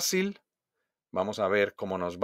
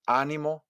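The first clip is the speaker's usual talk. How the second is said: "ánimo" stressed on its first A; stress on first syllable; 5 semitones higher than usual. Shown as a demonstration. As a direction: punch the A opening "ánimo". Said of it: "Entendamos la diferencia entre". ("ánimo" con acento en la primera A)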